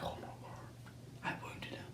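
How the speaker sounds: horrified whispered realization; hushed whisper. Retold or imitated. Hunter whispering his horror at the wounded deer. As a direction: breathe it out in dismay.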